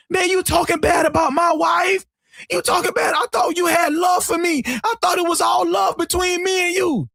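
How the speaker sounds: high, aggrieved whine in imitation; mock whining. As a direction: imitate an upset husband whining his complaint. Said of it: "he was whining".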